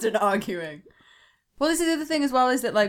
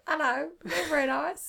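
mock female character; 8 semitones higher, 4 dB quieter than usual.

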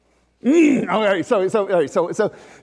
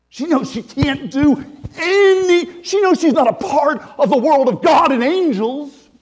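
rising fervour; 9 semitones higher, 7 dB louder, slower than usual. Acted. Preacher building over Mary's powerlessness.

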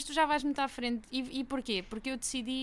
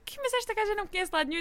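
surprised retold thought; 10 semitones higher, much faster than usual.

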